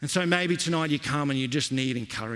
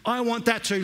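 preaching; 8 semitones higher than usual. Acted. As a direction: call it out from the pulpit. Preacher at full force.

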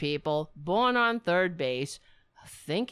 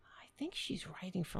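whispered, much softer murmur; whispering to herself; 13 dB quieter than usual. Real, not acted. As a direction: whisper while scrolling. Muttering while searching online.

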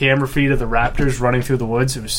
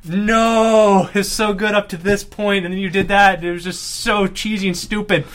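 higher, loud protesting nos; mock outrage; 7 semitones higher, 3 dB louder, slower than usual. Retelling his reaction to the ending, loud mock outraged protest. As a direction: rant at the screen in mock betrayal. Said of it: "And I was like".

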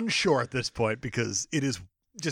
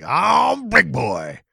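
very slow, louder boastful gangster; Big Boy; 4 semitones higher, 7 dB louder, much slower than usual. Acted. Crime boss answering Tracy at the club, boastful. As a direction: stretch it out big and swaggering. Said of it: "And he's like".

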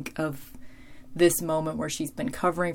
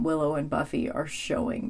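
ordinary delivery

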